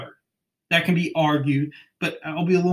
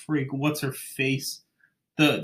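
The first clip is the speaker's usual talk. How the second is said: ordinary delivery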